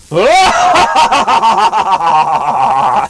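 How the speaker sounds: loud theatrical villain cackle; evil villain laugh. Pretend. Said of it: "give us your best evil villain laugh".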